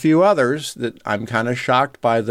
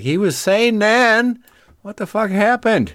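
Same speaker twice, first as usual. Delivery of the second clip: retold disbelief; 8 semitones higher than usual. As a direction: recount your baffled reaction, pitched up. Retells own shock at old messages.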